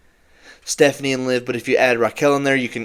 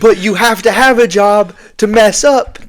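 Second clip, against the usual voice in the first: loud, high, indignant punch; heated jab; 8 semitones higher, 7 dB louder than usual. Real, not acted. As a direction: drive the dig home with raised voice. Ranting at online match critics.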